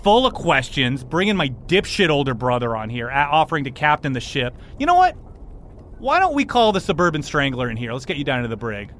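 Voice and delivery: indignant captain's tirade, pitch jumping up; angry captain. Acted. Captain to intruders on his ship, indignant. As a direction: rant at intruders, then threaten them briskly.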